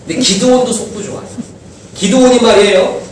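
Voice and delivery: ordinary talk, nothing marked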